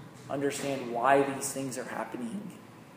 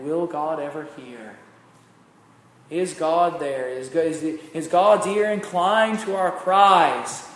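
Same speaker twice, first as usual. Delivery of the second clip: pleading cry; 3 semitones higher, 8 dB louder, slower than usual. Acted. Hebrews crying out, voiced plea.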